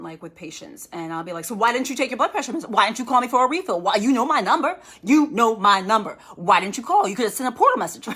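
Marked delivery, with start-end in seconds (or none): 1.4-8.1 loud, higher, rapid-fire scolding; really stern. Acted. Doctor scolding a patient about missed medicine, loud and stern. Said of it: "sometimes I get really stern".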